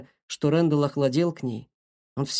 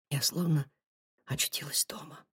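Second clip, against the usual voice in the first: shaky whisper; trembling whisper; 8 dB quieter than usual. A narrator's reading. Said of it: "прошептала она дрожащим голосом".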